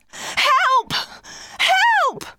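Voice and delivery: high calls for help; shouting for help. A narrator's reading. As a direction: call out to be heard far away. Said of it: "They all started to shout".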